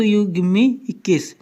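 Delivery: "you give me a kiss" blurred into one quick run, "me a kiss" sounding like "इक्कीस"; run together fast. Shown as a demonstration. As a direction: rattle it off as one word. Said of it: "तेज तेज बोलिए, जल्दी जल्दी बोलिए". ("you give me a kiss" जल्दी-जल्दी मिलाकर बोलना)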